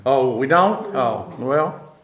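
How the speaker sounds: low, dry mock surprise at the class. Teacher teasing his class with mock surprise. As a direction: pretend they never think before speaking. Mock reply to imagined audience.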